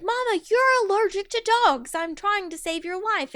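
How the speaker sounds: high, urgent pleading; hammed-up desperation; frantic son. Son frantic to save mother.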